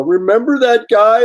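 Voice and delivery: high, loud, drawn-out reminiscence; imitating amused friend. Friend reminding the artist of the fan, high mock exclamation. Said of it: "start laughing and going".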